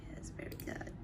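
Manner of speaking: soft whispered praise. Real, not acted. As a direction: breathe it out, barely voiced.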